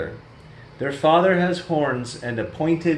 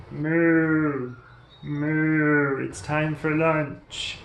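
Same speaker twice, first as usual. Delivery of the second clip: calf voice; 3 semitones higher, much slower than usual. Acted. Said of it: "She says".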